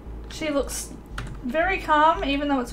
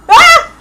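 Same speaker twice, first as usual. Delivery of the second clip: sudden high shriek of alarm; startled shriek; 15 dB louder than usual. Real, not acted. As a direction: shriek out in shock.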